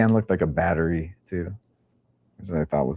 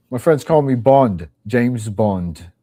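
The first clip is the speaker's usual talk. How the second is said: hammed-up spy introduction, louder at first; a light parody of a suave agent; mock James Bond intro; 5 semitones higher, 6 dB louder, slower than usual.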